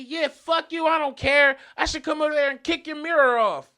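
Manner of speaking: high, loud threat; retold hostile shout; screams back. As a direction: imitate an irate man yelling a threat. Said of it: "he screams back at me".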